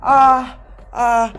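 high pained yelling, fading; screaming in pain. Real, not acted. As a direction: cry out through a cramp. Real cramp, shouting in pain.

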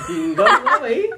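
laughing through a stuffed mouth; cheeks stuffed full. Real, not acted. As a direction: urge her on, mouth packed full.

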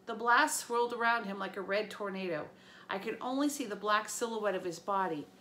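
ordinary delivery, nothing marked

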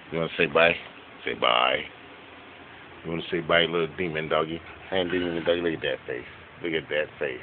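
soft cooing to the dogs; pet talk. Real, not acted. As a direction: coo gently at a puppy.

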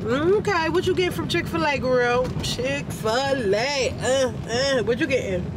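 high, drawn-out teasing; playful teasing. Real, not acted. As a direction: tease her playfully. Teasing wife about food choice.

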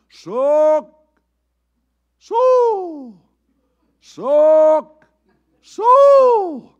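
long, high, loud hollered calls to the cows. Shown as a demonstration. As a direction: holler it out across the pasture like a farm boy calling cows. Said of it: "That was a cow call".

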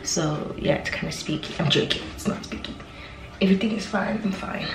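spooked hush, half joking; nervous whisper. Real, not acted. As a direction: whisper as if someone might hear you outside. Home alone, hears a noise outside.

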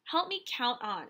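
ordinary talk

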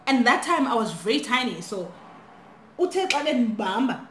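ordinary talk

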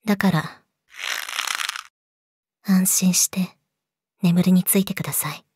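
soft menacing whisper. Acted. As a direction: breathe it into his ear. Lulling victim to sleep.

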